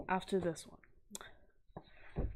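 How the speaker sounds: slow, hushed whisper; whispered aside. Real, not acted. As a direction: whisper it like a secret to viewers. Confidential aside to viewers.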